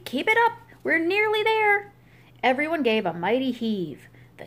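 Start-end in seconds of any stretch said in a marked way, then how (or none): 0.0-1.9 Mister Grumpy calling out high to the pushers; urgent cry. Acted. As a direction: cry out to keep them pushing. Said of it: "cried Mister Grumpy".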